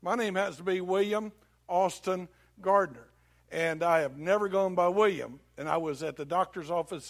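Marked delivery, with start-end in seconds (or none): none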